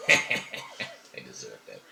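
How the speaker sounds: very low, slow, hushed menace; shadow voice. Acted. Shadow judges the dead bandits, low menacing voice.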